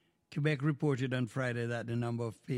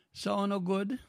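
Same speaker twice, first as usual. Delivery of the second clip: ordinary talk, nothing marked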